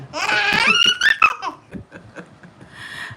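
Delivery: delighted high laugh; high squealing laugh. Real, not acted. Laughing with daughter.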